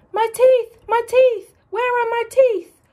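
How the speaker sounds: high, loud panicked cry; hammed-up alligator panic over lost teeth; panicked wail. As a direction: wail in a frantic search.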